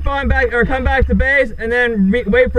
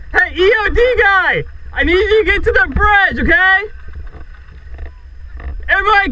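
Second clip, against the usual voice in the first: raised voice, calling across field; shouting orders; 7 semitones higher than usual. Real, not acted. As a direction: bark orders to the team. Directing team across the field.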